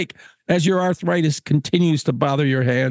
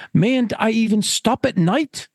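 higher-pitched, aggrieved gentleman; pleading Caswell; 7 semitones higher than usual. Acted. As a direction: plead with the keeper for relief.

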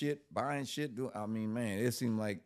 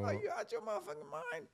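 much higher, outraged disbelief; incredulous yell. Retold or imitated. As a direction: yell at her like she's lost it. Arguing with girlfriend, retold.

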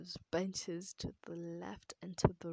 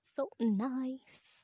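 "nice" higher and louder; loud, raised "nice"; emphatic gushing; 5 semitones higher, 8 dB louder than usual. Real, not acted. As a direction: gush with a big lift on "nice". Gushing, third take, louder.

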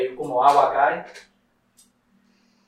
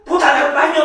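brother yelling; 11 semitones higher, 6 dB louder than usual. Retold or imitated. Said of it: "me dice".

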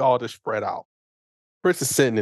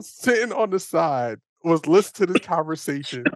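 ordinary talk

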